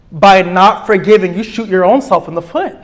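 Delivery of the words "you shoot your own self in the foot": usual speaking voice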